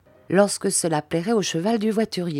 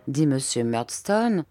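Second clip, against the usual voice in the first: ordinary delivery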